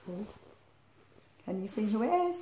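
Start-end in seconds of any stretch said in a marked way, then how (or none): none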